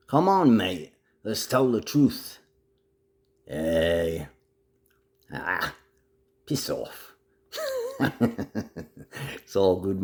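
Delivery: hammed-up, complaining Aussie mate; rally and grumble like an Aussie bloke; Aussie accent. Puts on Aussie mate talk.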